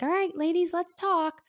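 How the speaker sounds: high, bright leader voice; rally the ladies to share; cheery group leader. Retold or imitated. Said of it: "our leader was like".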